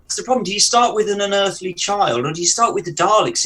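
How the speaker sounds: ordinary talk, nothing marked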